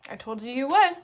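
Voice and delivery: loud, pointed gloating; teasing I-told-you-so. Real, not acted. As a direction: rub it in to the viewers, loudly. Teasing viewers about missing Impy.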